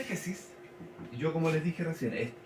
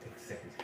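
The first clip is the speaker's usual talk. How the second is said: exégesis said softly, accent on "xé"; stress on the E; 15 dB quieter than usual. Shown as a demonstration. Teacher confirming the written accent, soft stress on the second E. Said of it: "con tilde en la E".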